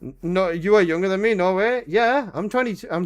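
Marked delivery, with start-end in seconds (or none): none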